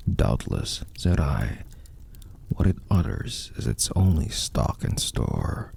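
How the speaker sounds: usual speaking voice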